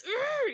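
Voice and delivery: high, loud "urgh" of exasperation; mock frustrated growl. Teacher and pupil at a standoff, exasperated groan. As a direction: let out an acted groan of frustration. Acted frustration in classroom quarrel.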